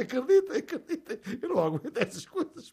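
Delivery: high, laughing helplessly. Real, not acted. Host to listeners, laughing high. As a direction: plead through giggles.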